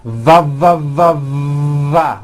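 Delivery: VA repeated with a buzzing, bitten-lip V; each VA starts on a buzzing V, the last held long; lip-biting V syllables. Shown as a demonstration. Teacher showing the V position, lip-biting buzzing VA. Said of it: "biting down on your lower lip".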